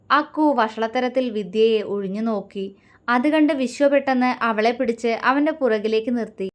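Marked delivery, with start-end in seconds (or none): none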